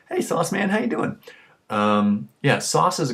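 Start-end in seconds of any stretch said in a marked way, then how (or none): 0.1-1.2 lightly voiced high-school buddy, higher; a hint of a cheerful buddy greeting. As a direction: call out a friendly hello to an old friend. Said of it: "nickname of one of my buddies".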